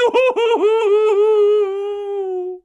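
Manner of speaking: very high, loud, sustained hooting laugh; long gleeful cackle. A narrator's reading. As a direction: howl with mocking poltergeist laughter. Peeves laughs at tricking Filch.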